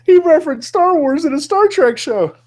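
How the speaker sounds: high, loud squeal of fake outrage; mock outraged nerd. Host mocking angry fans with a high squeal. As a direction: shriek it like a scandalized fan.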